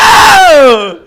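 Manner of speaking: long, high, loud ecstatic cry; hammed-up climactic howl; mock climax yell. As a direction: let out one huge drawn-out whoop. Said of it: "That was my impression".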